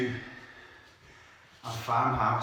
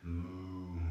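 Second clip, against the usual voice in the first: cow moo; 4 semitones lower, 7 dB quieter than usual. Shown as a demonstration. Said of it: "And the cow".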